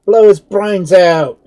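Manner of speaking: loud, high, slow angry shout. A narrator's reading. Second soldier demanding death, loud shout. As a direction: roar it for blood. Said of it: "shouted another".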